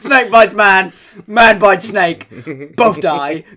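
loud, drawn-out newsreader headlines; Trevor McDonald. Acted. Reading the snake story as bulletin, mock newsreader voice. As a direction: announce each headline like a grave newsreader, laugh creeping in. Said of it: "I imagine Trevor McDonald reading it out like that".

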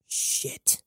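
breathy voiceless swear; just breathe the word out; whispered curse. A narrator's reading. Jazz muttering under pressure.

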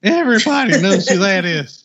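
a playful hint of a backwoods rascal; Ernest T. Bass voice. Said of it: "I'm gonna do the whole podcast as Ernest T".

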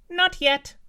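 apple answers the giant in a very high squeak; talking apple. Acted. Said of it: "replied the apple".

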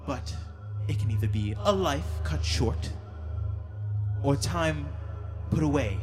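a harsher, colder fae queen. Acted. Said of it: "the Fey Queen's voice get a little bit harsher in tone".

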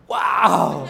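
a husband gawking at his wife's odd dress; mock wow. Husband reacting to wife's curtain dress, mock surprised wow. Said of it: "tú dices".

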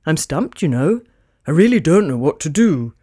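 a touch of despair in Santa; glum Santa. Acted. Santa stumped without his reindeer, glum low voice. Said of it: "said Santa glumly".

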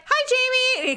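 high-pitched, dorky greeting; nerdy dentist. Retold or imitated. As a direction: imitate a chirpy nerd saying hi. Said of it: "'cause he's such a nerd".

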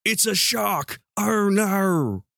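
high-pitched, hammed-up Australian accent. Shown as a demonstration. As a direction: put on a big, broad Australian accent. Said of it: "Let me get into Australian".

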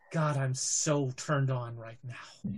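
low, soft and slow purr; sultry villain. Acted. Villain purring to the player after his escape. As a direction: savour each word, menacing and pleased. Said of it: "and she says".

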